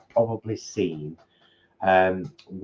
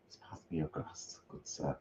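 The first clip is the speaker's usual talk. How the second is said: whispered aside; 6 semitones lower, 19 dB quieter than usual. Real, not acted. Aside while pouring wine.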